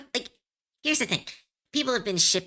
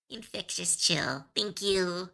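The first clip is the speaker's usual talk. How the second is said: ordinary delivery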